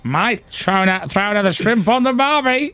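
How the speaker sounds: mock Australian. Host jokes about barbecues in a mock Australian accent. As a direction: sing out the barbie line like a joke Australian. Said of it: "and that's Australian".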